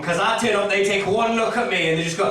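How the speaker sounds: ordinary talk, nothing marked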